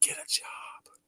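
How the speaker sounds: breathy whispered taunt, very soft; mock whisper. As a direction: hiss it like a secret in his ear. Whispering taunt into husband's ear.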